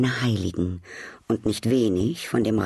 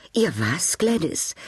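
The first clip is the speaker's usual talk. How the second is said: sudden shocked question, pitch jumping high on Gladys; startled cry; 6 semitones higher, slower than usual. A narrator's reading. Duchess startled by her hostess's odd word, high shocked cry. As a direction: blurt it out in alarm. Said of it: "rief die Herzogin in unwillkürlichem Erschrecken".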